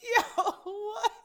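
high, loud laugh-cry; tearful disbelief. Real, not acted. Reacting to a friend's sad question, tearful disbelief. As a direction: blurt it through tears and laughter.

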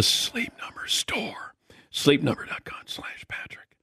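whispered ad read; whispering. Pretend. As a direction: read the ad in a hush. Whispering the ad, keep quiet joke.

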